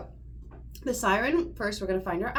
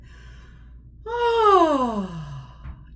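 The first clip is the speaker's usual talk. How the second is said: loud high sigh sliding down; high sighing siren; 6 semitones higher, 10 dB louder than usual. Shown as a demonstration. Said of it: "go up as high as you can".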